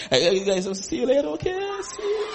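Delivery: climbing to a high, receding farewell; hurried goodbye. Acted. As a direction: call out a mock bye as you dash away. Mocking hasty goodbye while leaving.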